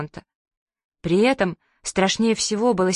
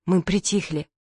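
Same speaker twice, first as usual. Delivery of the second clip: ordinary delivery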